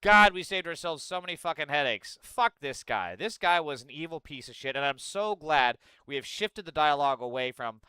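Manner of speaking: normal talking voice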